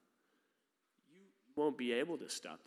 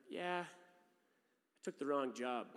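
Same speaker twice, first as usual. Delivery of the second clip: mock confession; much slower than usual. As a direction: own it with a wry shrug.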